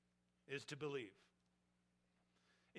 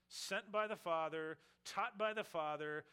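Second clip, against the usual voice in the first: louder, higher build; rising preacher cadence; 5 semitones higher, 6 dB louder than usual. Acted. Preacher climbing on sent by the Father, louder and higher. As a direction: climb toward the climax. Closing crescendo.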